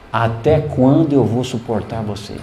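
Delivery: a hint of God speaking, low and slow; God's answer. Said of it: "E Deus respondeu assim pra mim".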